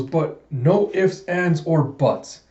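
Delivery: slow, word by word, leaving no room to argue; slow stern order. Acted. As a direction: spell it out slowly like a strict commander. Commander's stern radio order.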